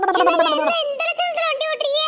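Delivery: high-pitched exasperated scolding; scolding complaint. Acted. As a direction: scold your husband's driving, pitched right up. Wife scolds husband's bad riding. (எரிச்சலோடு உச்சக் குரலில் திட்டு)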